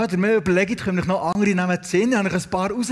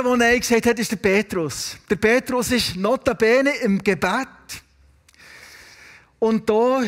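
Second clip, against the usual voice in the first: ordinary talk, nothing marked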